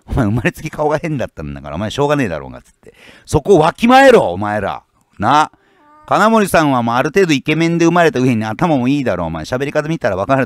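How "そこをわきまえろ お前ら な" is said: angry shout. Real, not acted. Scolding jealous internet commenters.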